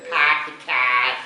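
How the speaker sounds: slow Boston accent, R's dropped from "pack the cat". Shown as a demonstration. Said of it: "they don't pronounce their R's".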